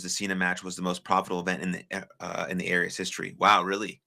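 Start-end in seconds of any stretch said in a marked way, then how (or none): none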